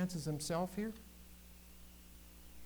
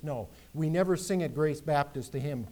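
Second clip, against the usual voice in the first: ordinary talk, nothing marked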